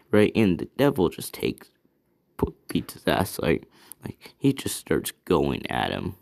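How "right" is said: high, light laughing "right"; laughing along. Acted. Asta laughing off the devil's anger, breezy. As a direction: laugh it off, carefree. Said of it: "He's, like, laughing about it too".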